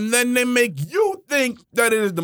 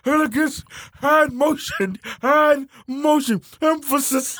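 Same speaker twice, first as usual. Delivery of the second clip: higher, laughing mock-elegant voice; mocking echo; 6 semitones higher, slower than usual. Mocking a friend's preacher-voice words, laughing imitation. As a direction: parrot each word up high, breaking into laughter.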